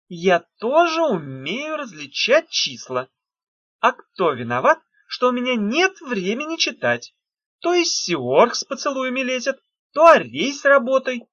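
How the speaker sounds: irritated high retort; snapping back. A narrator's reading. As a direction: snap back at him. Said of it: "огрызнулась Улита".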